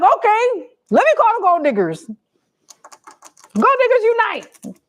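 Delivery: high-pitched, playful summons; high mock battle cry to friends; mock rallying call. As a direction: call the crew together in a high, hammed-up voice. Acts out her own rallying reply.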